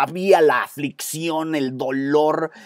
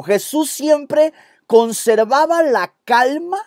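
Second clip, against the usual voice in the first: slow raised dictation with stress on siempre; slow dictation, higher; 9 semitones higher, 3 dB louder than usual. Shown as a demonstration. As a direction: dictate it slowly, leaning on "siempre". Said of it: "Anote eso en tu bosquejo".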